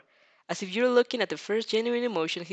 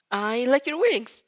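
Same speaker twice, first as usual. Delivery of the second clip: loud, higher, blurted in a rush of nerves; 5 semitones higher, 6 dB louder, slower than usual. A narrator's reading. Flustered fan blurting praise to Hawks, loud and eager. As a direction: burst it out before you can stop yourself. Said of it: "You burst out the first thing".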